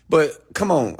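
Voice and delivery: ordinary talk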